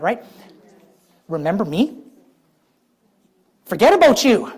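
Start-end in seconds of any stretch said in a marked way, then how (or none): 1.3-4.5 a touch of sarcastic brush-off, rising on the second phrase; lightly hammed-up rebuff; mock dismissive Lord. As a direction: pretend the Lord shrugs him off, half-joking. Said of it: "The Lord hasn't said that yet".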